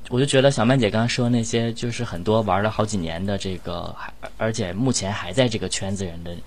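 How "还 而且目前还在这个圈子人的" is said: ordinary talk, nothing marked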